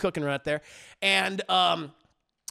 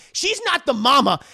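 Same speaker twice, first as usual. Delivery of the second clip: louder and louder to a shout; furious rant; 8 dB louder than usual. Retold or imitated. Girlfriend yelling at Harley about his real mother, furious. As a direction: tear into him, escalating. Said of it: "And she's like".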